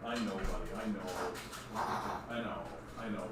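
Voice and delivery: soft, low, gentle murmur; calm him down softly; soothing murmur. Real, not acted. Owner soothes the choking pug, soft low murmur.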